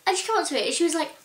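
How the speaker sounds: ordinary talk, nothing marked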